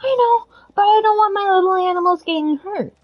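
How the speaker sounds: put-on boy worrying about animals, high; worried kid. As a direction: fret like a tender little boy. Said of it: "He's like".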